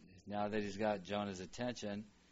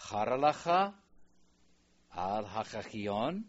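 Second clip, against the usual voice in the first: raised model reading; 6 dB louder than usual. Shown as a demonstration. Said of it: "model reading God's question".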